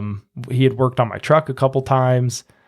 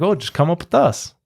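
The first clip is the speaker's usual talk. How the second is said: easygoing neighbor; 6 semitones higher than usual. Retold or imitated. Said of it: "he's like".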